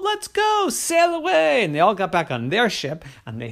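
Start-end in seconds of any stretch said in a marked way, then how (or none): 0.0-1.6 high, loud, eager pirates; greedy excitement. Acted. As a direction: rush off greedily.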